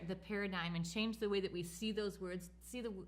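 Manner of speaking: normal talking voice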